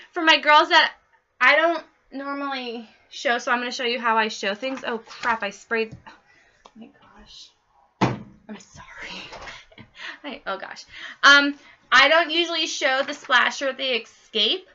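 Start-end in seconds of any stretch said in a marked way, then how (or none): 6.1-10.9 whispered, slow apology under her breath; embarrassed whisper. Real, not acted. Host whispering sorry after spraying by mistake. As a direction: whisper an oops to yourself.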